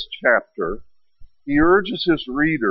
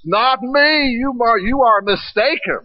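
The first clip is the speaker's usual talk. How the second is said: high, louder flustered denial; voicing Peter in flustered denial; 9 semitones higher, 3 dB louder than usual. Peter denying to the accuser, high and flustered. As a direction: deny it in a rush, pitched up.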